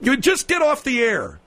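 irritated host giving orders; exasperated dismissal. Acted. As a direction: cut him off, fed up.

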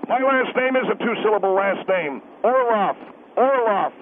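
ordinary talk

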